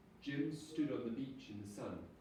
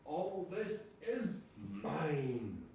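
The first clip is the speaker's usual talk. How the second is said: very slow, booming voice of the wind; roaring wind; 4 semitones higher, 3 dB louder, much slower than usual. A narrator's reading. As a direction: let the wind itself roar the claim. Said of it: "roared the wind".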